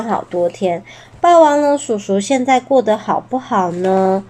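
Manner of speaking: ordinary delivery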